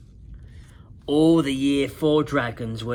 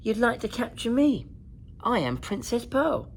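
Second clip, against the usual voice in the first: princess voice; 6 semitones higher than usual. A narrator's reading. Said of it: "she said".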